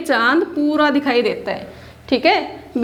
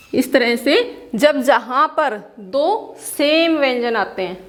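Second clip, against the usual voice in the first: ordinary delivery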